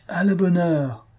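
high, drawn cry of approval; approving exclamation. A narrator's reading. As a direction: exclaim it with satisfaction, pitched well up. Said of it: "s'écria enfin le mendiant".

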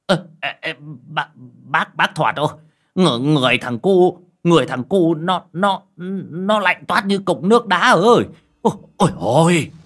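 high, loud, panicked stammering; terrified stammer. Acted. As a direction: blurt it out terrified, stumbling over words. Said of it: "Lão hói nói bằng giọng run rẩy sợ hãi".

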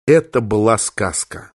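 plain reading voice

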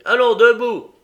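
loud high gruff command; rough order. Acted. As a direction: bark it at the boy. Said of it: "dit-il rudement".

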